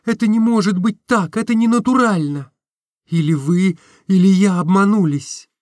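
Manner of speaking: put-on anxious young woman pleading; Nastenka's voice. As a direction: beg him to explain, upset.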